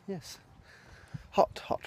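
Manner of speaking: ordinary delivery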